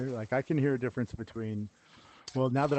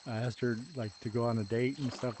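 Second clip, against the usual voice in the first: normal talking voice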